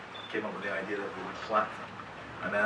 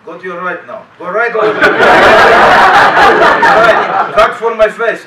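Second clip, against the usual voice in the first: loud, laughing mock Hungarian accent barking orders; hammed-up Hungarian accent, shouted and cracking up; 11 semitones higher, 24 dB louder, slower than usual. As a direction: imitate a Hungarian stunt coordinator yelling directions through laughter. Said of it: "this sort of Hungarian accent comes out of you".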